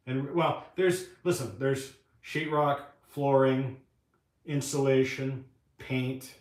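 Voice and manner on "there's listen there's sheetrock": usual speaking voice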